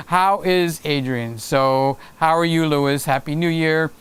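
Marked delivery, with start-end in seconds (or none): none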